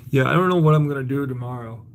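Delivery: normal talking voice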